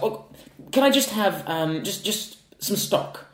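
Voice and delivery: high, loud, drawn-out mock customer voice; demanding customer. Imitating the customer asking for plain stock, mock demanding. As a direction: ask for stock like a fussy diner, hammed-up. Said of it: "She's like".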